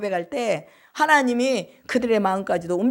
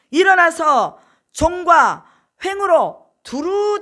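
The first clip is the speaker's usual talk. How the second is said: high, loud, drawn out very slowly; slow loud proclamation; 7 semitones higher, 6 dB louder, much slower than usual. Retold or imitated. Preacher as God telling Abraham to walk the land, slow and loud. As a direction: proclaim each word slowly and big.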